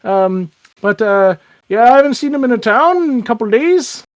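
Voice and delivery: small-town lilt, hemming and hawing; folksy Irish-Canadian officer, evasive. Acted. Town officer unsure where kids' parents are, folksy hedging. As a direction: amble through the answer as a local cop. [name] answers about parents.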